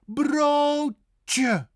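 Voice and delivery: high broodje with long rounded o; stretched broodje, rolled r; exaggerated Dutch pronunciation. Shown as a demonstration. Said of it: "round the lips".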